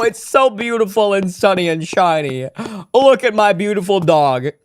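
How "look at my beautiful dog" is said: usual speaking voice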